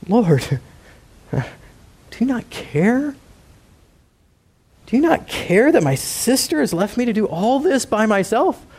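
high, whiny self-pitying complaint; Martha whining. Acted. Martha complaining to the Lord, high and self-pitying. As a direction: whine to the Lord like a put-upon sister. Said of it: "And she says".

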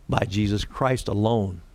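usual speaking voice

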